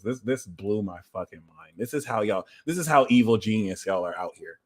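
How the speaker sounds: ordinary talk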